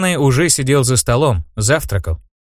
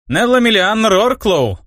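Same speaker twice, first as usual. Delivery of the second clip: slow, higher grand name; formal introduction; 9 semitones higher, slower than usual. A narrator's reading. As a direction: announce your full name proudly. (торжественное представление)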